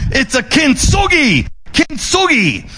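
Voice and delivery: playful Japanese accent. Shown as a demonstration. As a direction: keep hamming up the Japanese accent. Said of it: "in your best Japanese accent".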